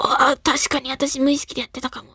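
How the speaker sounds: soft inner-thought voice, fading to a whisper; hushed realisation. Retold or imitated. Host recalling their own sudden realisation, hushed inner voice. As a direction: let the thought trail into a whisper.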